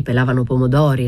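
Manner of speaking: usual speaking voice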